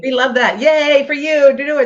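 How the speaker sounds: high, loud, bubbling cheer; squealing yay of support; excited cheer. Real, not acted. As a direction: cheer her on with delight. Cheering friend's comment.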